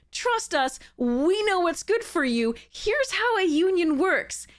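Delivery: mocking smug company. Creator mocking company's "trust us" pitch, high and patronizing. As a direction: sneer it like a condescending boss. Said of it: "people who are like".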